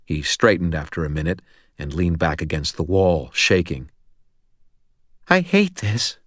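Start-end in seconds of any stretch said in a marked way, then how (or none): 5.3-6.2 high, thin, shaken voice; high-pitched, miserable near-whisper; whispered misery. A narrator's reading. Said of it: "he whispered and wiped his mouth".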